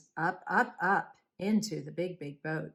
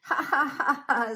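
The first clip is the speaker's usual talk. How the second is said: high, loud, staccato ha-ha jeer; mocking laughter; 7 semitones higher, 6 dB louder than usual. A narrator's reading. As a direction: act out a crowd laughing at Noah. Other people mock Noah.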